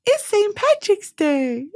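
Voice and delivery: mock the girl's little announcement; imitating the girl. Quoting the girl in the hotel room video, mock voice. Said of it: "she goes".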